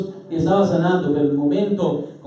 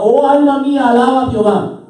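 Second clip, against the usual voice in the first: loud, high declaration; fervent shouted praise; psalmist exhorting himself; 9 semitones higher, 8 dB louder than usual. Acted. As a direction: cry it out to rouse the soul. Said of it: "le estaba diciendo a su alma".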